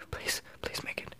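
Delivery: breathless whispered begging; pleading whisper. Real, not acted. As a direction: whisper it, willing the jump to land. Pleading for the jump to land.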